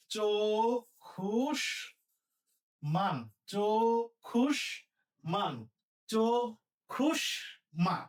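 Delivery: চক্ষুষ্মান very slowly as chok-khush-man; long pauses between the parts of চক্ষুষ্মান; syllable-by-syllable pronunciation. Shown as a demonstration. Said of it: "তাহলে হবে কি".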